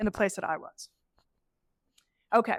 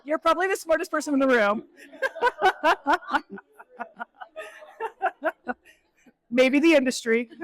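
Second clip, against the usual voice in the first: laughing tease; 5 semitones higher, 5 dB louder than usual. Real, not acted. Teasing burnout sign, laughing.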